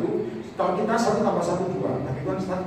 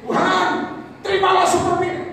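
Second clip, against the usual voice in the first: showing-off prayer; 12 semitones higher, 7 dB louder than usual. Retold or imitated. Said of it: "Itu namanya ada show".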